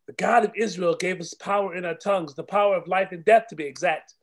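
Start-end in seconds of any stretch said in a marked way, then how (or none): none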